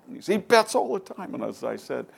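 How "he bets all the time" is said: very high, loud comic exclamation; exclaimed retold answer. Golfer answers the writer about Trump's betting, exclaiming. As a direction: blurt it out high, as if it's obvious.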